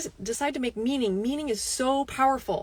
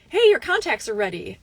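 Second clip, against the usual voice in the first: cheery caller; 7 dB louder than usual. Retold or imitated. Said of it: "and they said".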